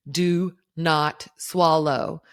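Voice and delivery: every word stressed, loud and slow; reading out caps. Retold or imitated. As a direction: read each word like a warning in capitals. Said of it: "it says in all caps".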